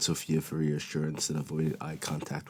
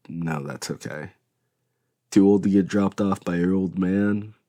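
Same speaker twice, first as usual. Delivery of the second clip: plain reading voice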